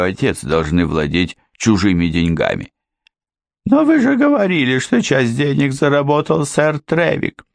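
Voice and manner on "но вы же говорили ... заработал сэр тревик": high, questioning housekeeper; puzzled objection. A narrator's reading. Housekeeper questioning Ford, high and puzzled. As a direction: press the point, pitched up.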